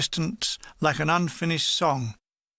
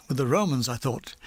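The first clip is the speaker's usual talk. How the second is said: plain reading voice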